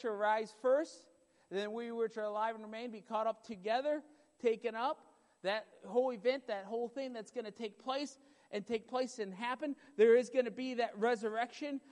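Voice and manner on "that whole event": ordinary talk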